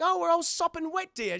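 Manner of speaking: high, put-on British voice; mock British accent. British sweetheart caught in pouring rain, high mock British accent. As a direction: ham up a dainty British accent.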